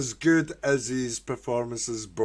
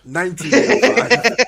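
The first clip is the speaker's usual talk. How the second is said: laughing correction; 10 semitones higher, 11 dB louder than usual. Real, not acted. Correcting date while laughing.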